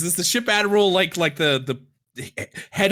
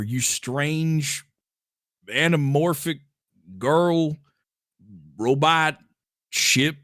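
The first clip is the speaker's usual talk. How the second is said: normal talking voice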